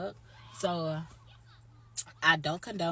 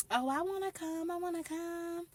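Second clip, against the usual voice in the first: much higher, pleading mock voice; whiny begging; 13 semitones higher than usual. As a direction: beg like a kid who wants to tag along. Bones begging to come.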